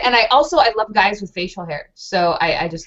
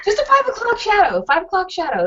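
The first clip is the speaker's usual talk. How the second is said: gushing; 9 semitones higher than usual. Real, not acted. Enthusing about facial hair.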